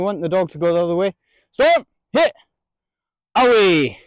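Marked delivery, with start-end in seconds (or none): 1.5-4.0 high, loud commands to the dog; firm commands to the dog; sharp dog commands. Shown as a demonstration. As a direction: snap the commands out.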